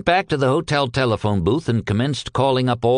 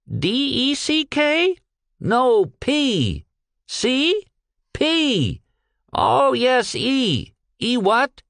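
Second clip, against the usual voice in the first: high, slow, confused servant voice; a servant who keeps mishearing the name, pitched high; muddled servant on phone; 11 semitones higher, much slower than usual. Acted.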